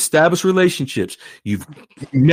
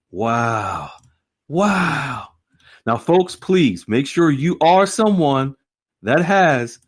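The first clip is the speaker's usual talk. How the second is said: ordinary delivery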